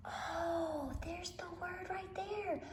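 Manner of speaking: soft whispered aside, high; secret whisper. Acted. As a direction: let them in on a secret. Teacher whispers a discovery.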